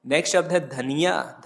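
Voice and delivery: ordinary talk, nothing marked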